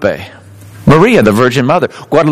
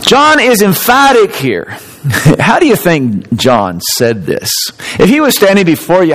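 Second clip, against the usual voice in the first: normal talking voice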